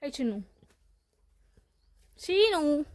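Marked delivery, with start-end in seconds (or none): 2.2-2.8 high, loud drawn-out call. Real, not acted. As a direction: call the pet's name to get its attention. Calling the goat to look. (जोरात लांबवून हाक मारत)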